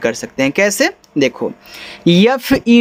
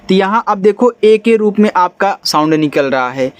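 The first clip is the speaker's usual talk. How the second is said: ordinary talk, nothing marked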